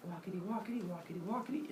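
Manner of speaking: soft, rhythmic walking patter; light, quiet sing-song steps; trotting along. Acted. Hera as old woman walks through the village, softly pattering.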